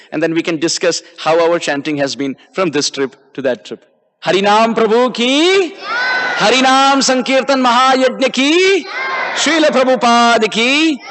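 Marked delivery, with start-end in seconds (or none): 4.2-11.0 high ringing devotional call, last word held; rally the congregation with rising calls; calling out cheers. Real, not acted. Preacher closes class calling for cheers, high drawn-out shout.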